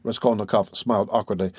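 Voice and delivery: usual speaking voice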